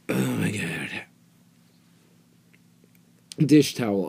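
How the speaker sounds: ordinary talk, nothing marked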